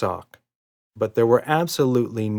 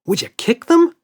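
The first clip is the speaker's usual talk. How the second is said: put-on little girl voice; 9 dB louder than usual. A narrator's reading. Emma voiced.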